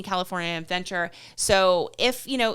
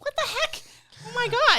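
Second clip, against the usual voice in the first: teasing outrage when her friend plans a trip without her; shocked disbelief. Real, not acted. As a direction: pitch jumps way up in disbelief.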